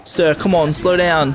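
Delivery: high, loud plea at the game; frustrated shout. Real, not acted. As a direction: call out in exasperation. Yelling at opponent.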